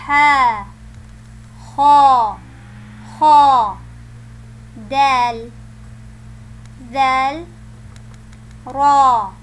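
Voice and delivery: loud, slow letter names one by one; alphabet recitation. Shown as a demonstration. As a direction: call out each letter for the children.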